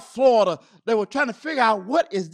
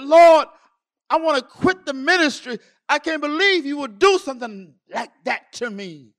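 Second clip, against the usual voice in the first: retold cry that starts loud and high, then eases; anguished protest; 4 semitones higher, 6 dB louder than usual. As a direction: cry out to God in hurt. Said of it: "I cried out in anguish".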